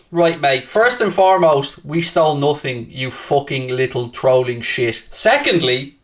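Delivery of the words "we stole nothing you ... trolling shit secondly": normal talking voice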